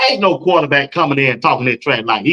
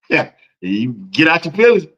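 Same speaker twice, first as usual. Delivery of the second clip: usual speaking voice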